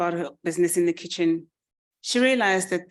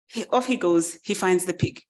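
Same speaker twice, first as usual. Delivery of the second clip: usual speaking voice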